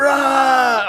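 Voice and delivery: loud, high, sustained roar; playful roar. Retold or imitated. Answering the schoolkids' roar, loud playful roar. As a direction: roar back at full voice, in play. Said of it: "And I go".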